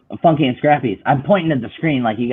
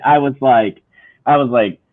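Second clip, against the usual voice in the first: normal talking voice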